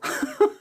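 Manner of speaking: very high quick laugh; a sharp little scoffing laugh; short laugh. Real, not acted. Laughing at a viewer's comment.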